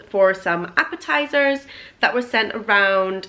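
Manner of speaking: ordinary delivery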